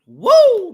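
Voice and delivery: high, loud "woo"; sudden hollered cheer; excited whoop. Real, not acted. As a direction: let out a piercing whoop. Whoops after shout-out.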